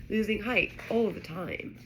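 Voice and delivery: ordinary delivery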